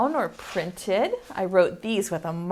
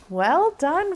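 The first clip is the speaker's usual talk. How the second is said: usual speaking voice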